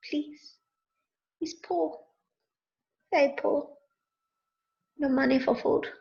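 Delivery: small, halting mock plea; poor beggar voice. Host with begging bowl asking for food, play-acted pleading. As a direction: plead in little broken phrases. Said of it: "poor accent".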